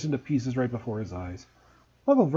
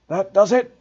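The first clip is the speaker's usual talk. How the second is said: barely contained anger; 5 semitones higher, 8 dB louder than usual. A narrator's reading. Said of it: "trying to speak calmly".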